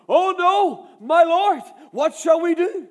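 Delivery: loud, high panicked cry. A narrator's reading. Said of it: "the servant asked".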